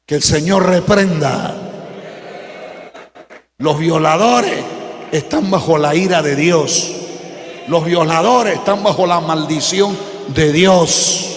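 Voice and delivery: loud, preaching at full force; fiery preaching. Acted. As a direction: thunder the condemnation from the pulpit. (predicación a todo pulmón)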